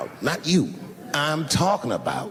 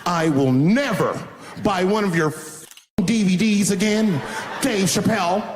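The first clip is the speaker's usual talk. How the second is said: indignant parting dig; 6 semitones higher than usual. Retold or imitated. Upset wife to comedian on her way out, indignant. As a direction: imitate an offended customer storming out. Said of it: "take one last dig at me".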